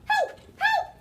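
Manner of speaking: high, loud repeated cries; screaming harp; harp crying help. Acted. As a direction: cry out in alarm as the harp. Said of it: "The harp began to cry".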